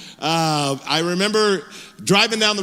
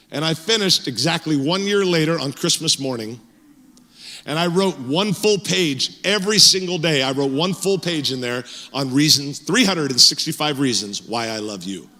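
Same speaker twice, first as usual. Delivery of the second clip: normal talking voice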